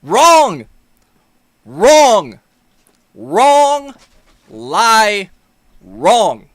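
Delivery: loud, repeated shouts of Wrong; shouting Wrong. Real, not acted. Yelling at the clip, loud and repeated. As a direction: yell it back at the screen each time.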